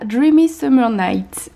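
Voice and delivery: put-on slow, careful English accent on "Dreamy Summer Night". Vlogger reading a candle name to viewers, in a light English accent. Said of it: "pour mon accent anglais".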